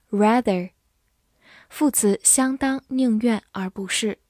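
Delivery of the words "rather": "rather" in an American accent; American pronunciation. Shown as a demonstration. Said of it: "美式发音".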